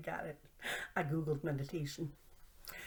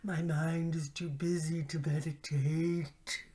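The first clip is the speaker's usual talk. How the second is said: slow mocking complainer voice, lightly put on; 4 dB louder, slower than usual. Quoting what everybody says, mock complaint. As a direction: imitate everyone's whiny excuse. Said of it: "everybody says".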